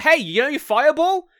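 hyped-up spellbook. Retold or imitated. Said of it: "It's like".

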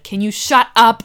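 loud, slow mock snap; snapping at dad. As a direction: fire it back loud at dad. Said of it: "I'll be like".

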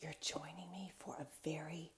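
soft secretive whisper. Acted. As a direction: hush it like a secret. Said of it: "sneaky episode".

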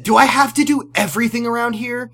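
hammed-up fed-up mom; mock exasperation. Acting exasperated mother.

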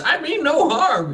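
put-on high, slow pleading old man; high cracked old farmer pleading innocence. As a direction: beg like a frightened old farmer.

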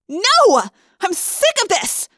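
high, loud outburst; frustrated yell. A narrator's reading. Rachel, human again after losing Visser Three, yelling in frustration. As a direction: yell it out, fed up. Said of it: "I yelled".

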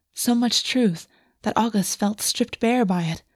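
ordinary delivery, nothing marked

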